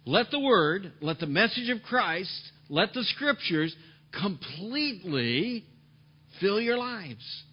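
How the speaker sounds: slow, loud, deliberate quoting; preacher quoting the verse slowly and forcefully to the congregation; emphatic reading. Shown as a demonstration. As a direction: lay each phrase down heavily and slowly. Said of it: "No, he said".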